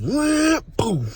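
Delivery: a sudden high crash noise, wham then boom; crash sound effect. Retold or imitated. Retelling the head-on collision to viewers, a vocal crash effect. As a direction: mimic the impact of the car hitting you.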